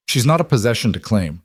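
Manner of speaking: plain reading voice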